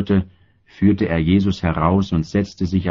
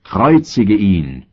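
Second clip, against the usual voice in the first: crowd shouting; 2 semitones higher, slower than usual. A narrator's reading. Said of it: "Sie schrien aber".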